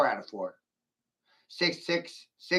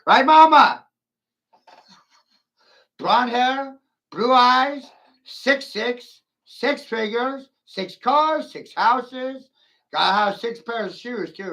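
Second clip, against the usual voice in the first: mocking list; 6 semitones higher, 6 dB louder, slower than usual. Mocking women's demands.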